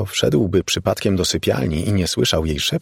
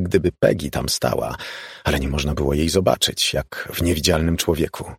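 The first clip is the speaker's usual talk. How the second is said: ordinary delivery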